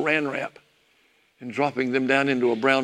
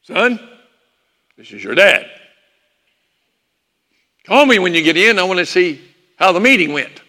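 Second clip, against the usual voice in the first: punchy fatherly voicemail, imitated; dad's answering-machine message; 2 semitones higher, 6 dB louder than usual. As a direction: leave a message like an emphatic father. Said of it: "this is what it would sound like".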